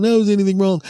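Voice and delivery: high and fast, teasing; mock friend's voice. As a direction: chirp it back like a carefree friend. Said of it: "I said".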